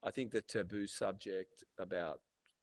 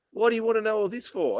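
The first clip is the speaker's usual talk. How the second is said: mimicking the doctor, much higher and louder; dismissive doctor; 14 semitones higher, 12 dB louder than usual. Telling the host how his doctor questioned him, mock dismissive. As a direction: toss it off like a doctor brushing him aside.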